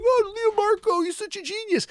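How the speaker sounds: high-pitched gushing flattery; exaggerated high admirer voice; mock fawning. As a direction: squeal praise, mocking the admirers.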